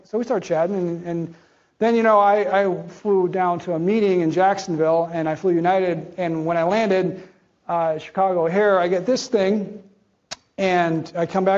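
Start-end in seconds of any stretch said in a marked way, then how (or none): none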